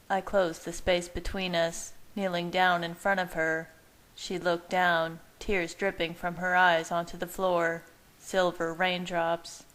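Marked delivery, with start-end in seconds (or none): none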